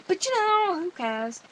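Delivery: high, loud, drawn-out 'you know'; playful careless lilt; flippant singsong. Real, not acted. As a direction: swoop up high, making light of it. Shrugging off family talk.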